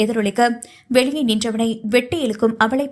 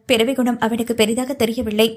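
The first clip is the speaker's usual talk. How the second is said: ordinary delivery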